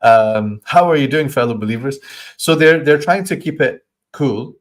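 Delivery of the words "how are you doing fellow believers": high, louder mock-friendly hello; fake casual greeting. Hypocrite cheerfully greeting the believers, mock-friendly. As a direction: overdo the friendliness. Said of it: "like the meme".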